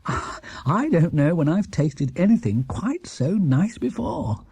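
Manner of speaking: slow, lip-smacking praise, rising high on the hums; savouring gourmet. Acted. Mr Brown praising Paddington's stew over a second helping, slow and relishing. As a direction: relish every word like a food critic.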